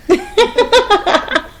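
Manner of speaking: high-pitched burst of laughter; laughing at oneself. Real, not acted. Host tripped by own accent, high embarrassed laugh. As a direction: crack up at your own slip.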